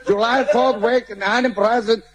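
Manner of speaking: high, slow fake English gibberish; hammed-up gringo babble; mock American.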